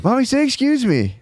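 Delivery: very high-pitched little-kid impression; Maya. Little daughter announcing on the plane that mommy farted, mimicked child voice. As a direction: pipe up like a small child insisting on manners. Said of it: "when they, when little kids get, like, real obsessed with manners".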